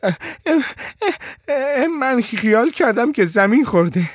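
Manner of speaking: put-on hesitant, high child's voice; frightened stammer. A narrator's reading. Boy answering the shouting father, stammering and frightened.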